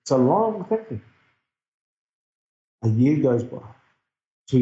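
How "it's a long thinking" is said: amused echo. Retold or imitated. Repeats listener's line, amused.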